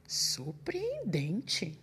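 very slow, drawn-out awed whisper; astonished whisper. Acted. Gruffalo astonished the owl fled, slow whisper. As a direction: breathe it out in amazement. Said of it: "falou espantado".

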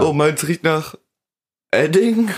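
hoch und lauter ausgerufen; überrascht. Real, not acted. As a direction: erstaunt herausplatzen. Überraschter Geruchsvergleich. (überrascht ausgerufen)

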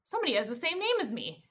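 mock clueless wonder; puzzled realization. [name] baffled at hearing his name.

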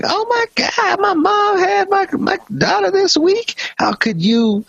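very high-pitched hammed-up wail; exaggerated tearful lament, way up high; mock bawling. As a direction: imitate a woman bawling in a squeaky voice. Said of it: "Jessica starts bawling".

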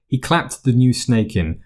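ordinary delivery

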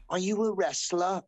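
put-on high, childlike little boy asking; little kid voice. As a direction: play a small eager English kid. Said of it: "looked like a little Oliver Twist".

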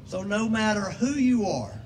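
rhythmic preaching litany, building. Acted. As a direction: preach each line with rising fervour.

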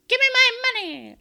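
high, loud cry of demand; mock demanding yell. Acting Rita's gun-point demand.

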